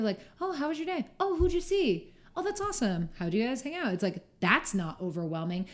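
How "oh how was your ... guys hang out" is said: high-pitched, friendly string of questions; caring interest. Acted. Speaker voicing a caring partner asking about the day, interested. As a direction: imitate a partner happily asking after your day. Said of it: "cares about you".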